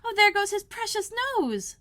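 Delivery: Alice panicking as dishes fly; terrified cry. Acted. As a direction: cry out in alarm. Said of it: "in an agony of terror".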